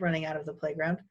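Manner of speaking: ordinary delivery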